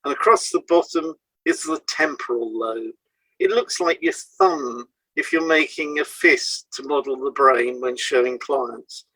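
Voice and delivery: normal talking voice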